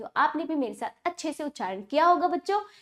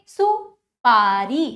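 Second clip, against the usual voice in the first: सुपारी slow and loud, split after सु; drawn-out classroom सुपारी; broken-up pronunciation; 3 semitones lower, 5 dB louder, much slower than usual. Shown as a demonstration. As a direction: stretch सुपारी syllable by syllable. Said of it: "तोड़कर जोड़कर उच्चारण".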